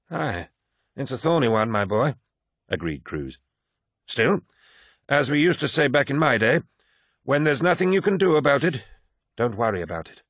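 put-on garrulous old warrior holding forth; old veteran Cruz. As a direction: lecture the young man like a proud old-timer. Narrator voices old Cruz.

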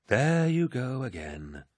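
slow, sighing exasperation; weary sigh. Acted. Torgadden tires of Eidolon invoking Horus, sighing slowly. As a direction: sigh it out, tired of his nonsense. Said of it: "Torgadden sighed".

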